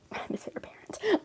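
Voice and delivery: breathy whispered repeat, half to herself; conspiratorial whisper; whispered aside. Real, not acted. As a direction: drop to a secret whisper.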